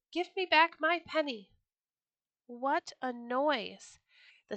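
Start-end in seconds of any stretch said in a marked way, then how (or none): 0.1-1.5 high, loud demand; crying out. Acted. Rooster on the fence shouting at the Sultan, high and loud. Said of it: "he cried".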